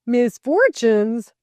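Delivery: loud, drawn-out incredulous echo; rallying. A narrator's reading. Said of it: "rallied Delcote".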